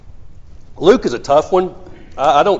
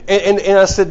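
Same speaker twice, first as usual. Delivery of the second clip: usual speaking voice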